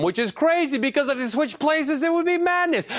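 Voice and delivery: over-dramatic. Acted. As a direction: build it up like a movie trailer. Mock-dramatic Wife Swap recap.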